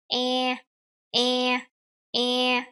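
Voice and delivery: EH with mouth stretched wide. Shown as a demonstration. Teacher shows the mouth shape, EH stretched and level. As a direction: closer EH held level. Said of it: "you need to stretch your mouth".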